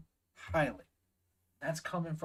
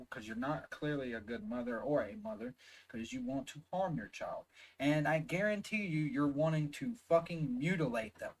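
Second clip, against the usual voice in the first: ordinary delivery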